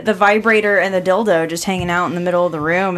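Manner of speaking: normal talking voice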